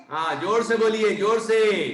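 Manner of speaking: loud, insistent prompt. Real, not acted. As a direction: call out to the class to speak up. Urging students to repeat louder.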